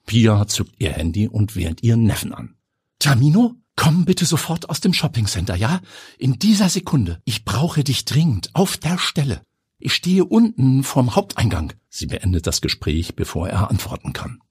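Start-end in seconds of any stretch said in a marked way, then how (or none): 3.0-11.7 higher, pressing woman's voice; urgent aunt on the phone. Acted. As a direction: hurry him out right now. Pia ruft dringend den Neffen. (dringlich, höher am Telefon)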